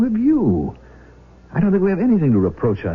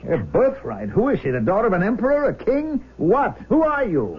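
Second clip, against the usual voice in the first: incredulous; 10 semitones higher than usual. Acted. Father challenges the caller's claim.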